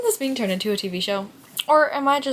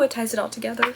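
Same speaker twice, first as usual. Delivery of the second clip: ordinary talk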